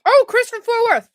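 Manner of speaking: high, loud delighted call; real excited outburst; excited exclamation. Real, not acted. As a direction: exclaim as if spotting a friend. Recognizes the sender with delight.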